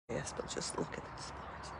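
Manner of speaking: hushed, breathless whisper. Real, not acted. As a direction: whisper so the dogs stay settled. Whispering so dogs stay calm.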